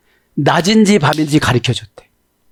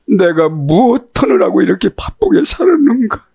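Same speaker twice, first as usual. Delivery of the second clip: higher, regretful wail; lament; 9 semitones higher than usual. Retold or imitated. Grieving child after a parent's death, lamenting. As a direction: mourn it aloud, regretful.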